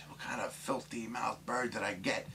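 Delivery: high-pitched mock indignation; disgusted. Woman scolds her foul-mouthed new parrot, disgusted. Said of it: "She's disgusted".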